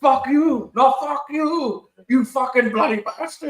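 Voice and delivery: high mock Indian-accented retort; arguing Indian man. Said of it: "those two Indian dudes are, uh, going at it".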